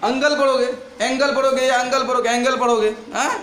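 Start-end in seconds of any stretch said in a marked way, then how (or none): 0.0-0.3 अंगल with an "a" opening and hard g; hard g misreading. Shown as a demonstration. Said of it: "तुम लोग क्या पढ़ोगे". 1.0-2.3 एंगल then अंगल, both with hard g; change only the first vowel from एंगल to अंगल; hard g misreadings. Shown as a demonstration. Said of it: "तुम लोग क्या पढ़ोगे".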